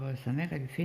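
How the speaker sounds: ordinary talk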